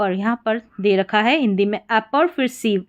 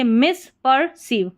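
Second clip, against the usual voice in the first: मिसपर्सीव said in separate parts, mis, per, seev; broken into parts; much slower than usual. Shown as a demonstration. Teacher builds the word for learners, broken into parts. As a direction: split मिसपर्सीव into its three chunks.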